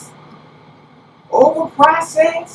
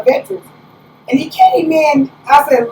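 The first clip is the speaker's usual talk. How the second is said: ordinary talk, nothing marked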